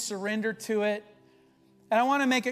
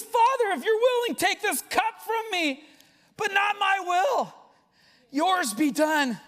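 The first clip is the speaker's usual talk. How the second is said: anguished plea; 8 semitones higher than usual. Acted. Jesus begging his Father in the garden, anguished cry. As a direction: cry out like a man in agony before God. Voicing Jesus praying in Gethsemane.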